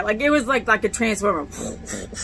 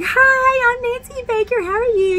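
much higher, bright friendly church-lady greeting; Nancy Baker; 13 semitones higher than usual. Retold or imitated. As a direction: greet a newcomer sweetly, pitched way up. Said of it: "And she was like".

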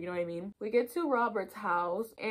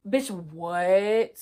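loud, stunned disbelief; shocked outburst; 7 dB louder than usual. Real, not acted. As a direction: blurt it out in shock. Shocked reaction to betrayal.

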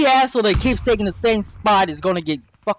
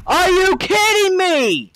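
high, drawn-out cry of disbelief; exasperated outburst; 8 semitones higher, slower than usual. Real, not acted. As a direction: yell it at the screen in frustration. Raging at an online shooter.

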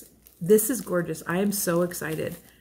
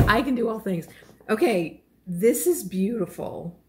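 normal talking voice

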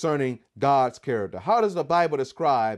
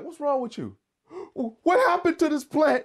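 questioning God; 10 semitones higher than usual. Acted. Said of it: "God is like".

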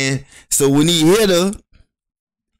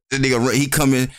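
ordinary delivery

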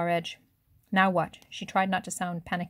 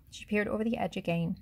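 ordinary delivery, nothing marked

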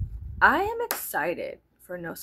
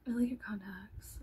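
mock snide whisper; 9 dB quieter than usual. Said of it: "And she was like".

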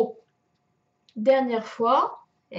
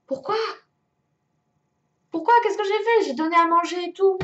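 high, quick indignant complaint; indignant protest; 6 semitones higher, 4 dB louder, faster than usual. Real, not acted. Player who fed everyone protests, indignant. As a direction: protest your innocence, pitch raised. Protesting unfair loss in game.

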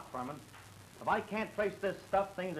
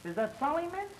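surprised; 10 semitones higher than usual. Acted. Recognises a name on paper.

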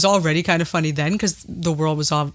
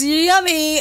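mock giggly yummy; 10 semitones higher than usual. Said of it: "she giggles and says".

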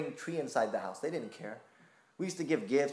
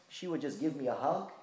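ordinary talk, nothing marked

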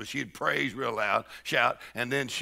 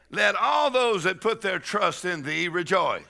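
scripture reading; 6 semitones higher, 4 dB louder than usual. Acted. Preacher reads scripture with force.

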